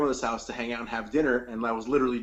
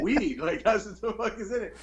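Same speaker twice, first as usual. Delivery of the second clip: higher, laughing through it; laughing reply; 7 semitones higher than usual. Retold or imitated. Grandson laughingly tells grandma what was in it. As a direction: laugh as you say it. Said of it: "And I go".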